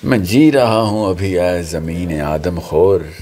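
slow, measured Urdu verse reading; ghazal recitation. Real, not acted. Poet's son reciting his father's ghazal to friends, measured recitation. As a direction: recite poetry with weighted pauses.

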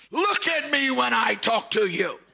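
high, slow, drawn-out scolding; scolding mother. Retold or imitated. Preacher imitating his mother scolding him, drawn out. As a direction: stretch every word like an exasperated mom. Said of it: "And she'd say".

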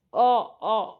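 trying to speak through a hand over the mouth; muffled, wordless "ऑ, ऑ" sounds; muffled mumbling. Acted. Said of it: "माया बोलण्याचा प्रयत्न करत होती".

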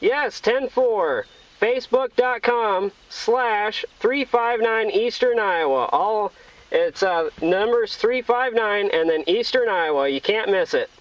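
normal talking voice

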